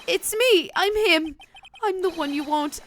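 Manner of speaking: high, loud, pleading to be blamed; eager confession. Acted. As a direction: beg the judge to take you.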